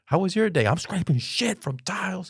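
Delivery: high-pitched acted exchange; exasperated retelling. Parent answering wife after scraping tiles, exasperated. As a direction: play up the fed-up answer.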